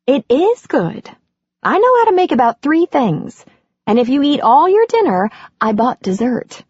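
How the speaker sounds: lightly voiced playful hostess; Andy, teasing. Andy jokes about dinner.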